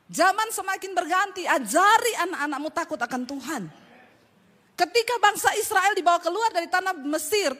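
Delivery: berkhotbah keras dan tinggi dengan penuh semangat; khotbah berapi-api. Acted. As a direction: serukan firman Tuhan kepada jemaat. (pengkhotbah berseru kepada jemaat dengan suara keras)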